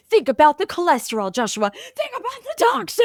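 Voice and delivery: quick scolding health lecture rising to a high mock cry; theatrical [name]. [name] scolding Joshua over his bologna sandwich, a hammed-up high mock cry. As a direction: nag Joshua about his food, then shriek the last line. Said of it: "that was my very theatrical".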